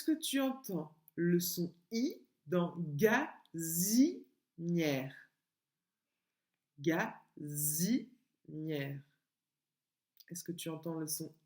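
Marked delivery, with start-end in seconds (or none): 2.8-5.1 "gazinière" slowly split as ga, zi, nière; syllable by syllable. Shown as a demonstration. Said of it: "Et sur quelle syllabe". 6.8-9.0 "Gazinière" very slow, split as ga, zi, nière; syllable by syllable. Shown as a demonstration. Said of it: "Et sur quelle syllabe".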